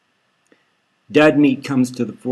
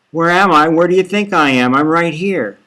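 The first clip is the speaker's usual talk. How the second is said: higher, louder and rushed, near panic; hysterical; 4 semitones higher, 6 dB louder than usual. Acted. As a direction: blurt it out in a frantic rush. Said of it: "She's hysterical".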